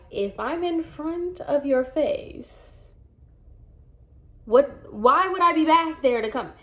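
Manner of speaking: incredulous. Retold or imitated. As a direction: stretch it out in exasperated disbelief. Incredulous retort to front-desk mistake.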